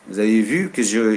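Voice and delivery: ordinary delivery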